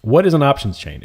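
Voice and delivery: ordinary talk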